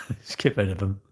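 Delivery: said through a laugh. Real, not acted. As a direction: chuckle your way through it. Repeats the line, then laughs.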